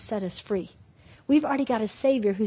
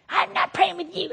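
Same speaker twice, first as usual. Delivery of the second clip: put-on defiant child; 7 semitones higher than usual. Defiant child refusing prayer.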